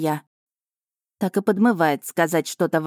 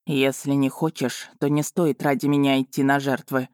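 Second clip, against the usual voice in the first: sarcastic jab; 2 semitones lower than usual. A narrator's reading. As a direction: needle her with a mocking edge. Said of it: "саркастической насмешки".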